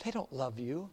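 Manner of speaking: hushed accusing devil. Acted. Devil accusing at judgment seat.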